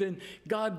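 ordinary delivery

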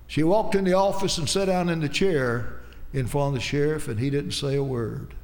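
ordinary talk

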